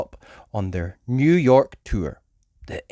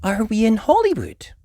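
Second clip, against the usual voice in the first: Mummy Pig; 11 semitones higher than usual. Acted.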